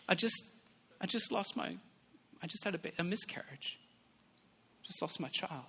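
very soft, halting confession; quiet grief. Retold or imitated. Crying woman telling of miscarriage, hushed halting grief. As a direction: retell grief softly, with long pauses. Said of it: "she's crying".

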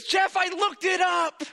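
high, slow mock shout; drawn-out, high-pitched excitement; excited wife. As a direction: imitate a wife announcing a big discovery. Retells wife's excited announcement.

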